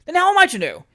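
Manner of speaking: high, loud indignant question; exasperated, high-pitched comeback; exasperated retort. A narrator's reading. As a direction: fire it back, voice shooting up. Mayor's exasperated retort to lady.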